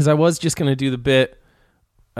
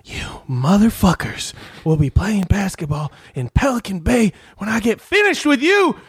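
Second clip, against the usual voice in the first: raised, heated threat; Denzel rant impression; 5 semitones higher than usual. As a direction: spit the threat like a dirty cop. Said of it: "Denzel Washington's character".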